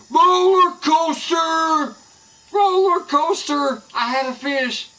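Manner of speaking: high, drawn-out mock shout of roller coaster; mocking roller coaster call. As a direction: ham up the other angler's catchphrase, loud and high. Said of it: "is this where I go".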